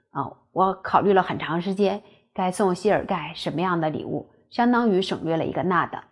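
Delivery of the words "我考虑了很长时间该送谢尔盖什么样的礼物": ordinary talk, nothing marked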